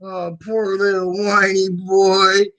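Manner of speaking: high, slow sing-song taunt; mock pity. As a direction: coo with fake sympathy, stretching it out. Taunts a whiner after clip.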